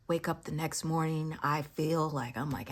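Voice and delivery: ordinary talk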